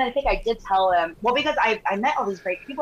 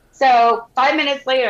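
ordinary talk, nothing marked

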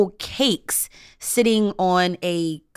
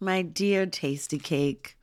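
fond nostalgic; 3 semitones lower, 8 dB quieter, slower than usual. Acted. Fond ode to childhood snack.

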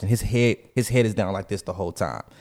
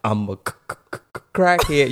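Samuel Jackson quote; 3 semitones higher than usual. Retold or imitated. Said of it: "Samuel Jackson in the movie, um, Jungle Fever".

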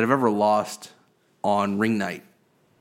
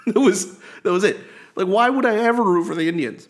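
laughing disbelief; 9 semitones higher, 4 dB louder than usual. Real, not acted. Incredulous about rooting for Indians.

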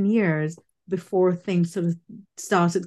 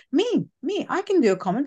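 high-pitched mock-eager volunteer; 6 semitones higher than usual. Acting out volunteering for a press comment, eager. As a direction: pipe up keenly, as if putting yourself forward.

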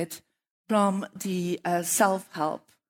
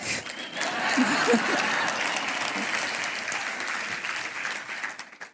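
soft unvoiced laugh; 3 semitones higher, 4 dB quieter than usual. Real, not acted. Laughs after "Check.

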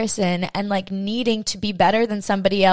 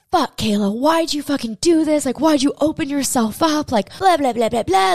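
self-scolding ego; 7 semitones higher than usual. Retold or imitated. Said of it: "my ego was beating me up".

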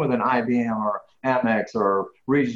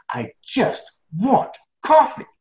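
pleading; 9 semitones higher, 3 dB louder, much slower than usual. Retold or imitated. Pleading with hotel clerk.

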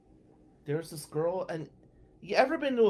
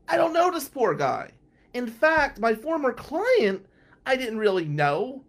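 higher, louder frantic rant; exasperated rant; 9 semitones higher, 7 dB louder than usual. Real, not acted. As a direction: pour it out in rising exasperation.

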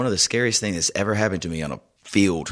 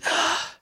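sharp gasp for air; imitated gasp. Choking girl breathing at last, imitated gasp. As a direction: suck in air as if finally able to breathe. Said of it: "she finally could breathe".

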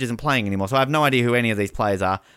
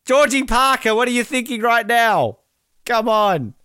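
mock appeal; 9 semitones higher, 4 dB louder than usual. Real, not acted. Calls out to the coach.

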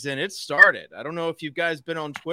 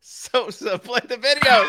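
laughing; 8 semitones higher, 7 dB louder than usual. Real, not acted. Laughing at intro.

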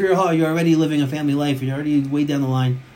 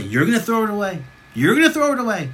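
incredulous; 5 semitones higher than usual. Real, not acted. Challenges listener with disbelieving rhetorical question.

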